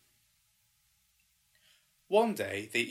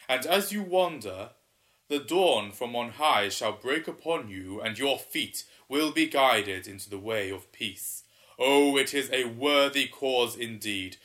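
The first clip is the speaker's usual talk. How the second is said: booming water spirit; 7 dB louder than usual. Acted. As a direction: boom it out like an ancient river spirit. Waterman speaks to the pair.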